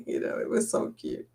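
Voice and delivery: breathy whispered aside; hushed little aside. Real, not acted. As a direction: drop to a whisper, just for the viewers. Aside after gushing over video.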